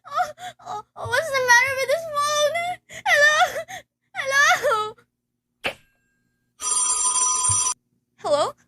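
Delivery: frantic and tearful at a dead line; panicked crying. Acted. Alone at night, she cries into the silent phone.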